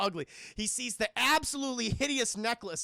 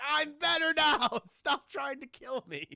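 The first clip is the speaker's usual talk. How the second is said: laughing plea; 7 semitones higher, 5 dB louder, slower than usual. Retold or imitated. Ash laughing while pleading.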